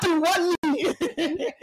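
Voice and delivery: hammed-up generic African accent; mock African accent. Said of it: "That's my authentic African accent".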